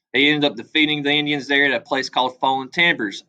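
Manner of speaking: ordinary talk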